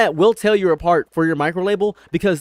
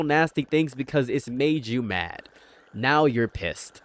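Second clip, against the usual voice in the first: normal talking voice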